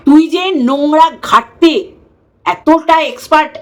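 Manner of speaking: mocking the rival with slow stress; slow scornful emphasis. Real, not acted. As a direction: spell out the scorn slowly. Scolding rival vlogger.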